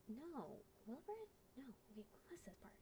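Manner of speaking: very soft, whispered thinking aloud; whispered self-talk. Real, not acted. Storyteller checking the order of the story, whispering to self. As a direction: whisper it to yourself.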